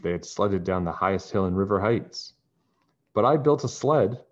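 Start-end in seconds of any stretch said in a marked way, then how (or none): 3.1-4.2 loud, proud boast. Acted. Bess bragging to friends about her sled, proudly. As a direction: puff up with pride. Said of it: "Bess said proudly".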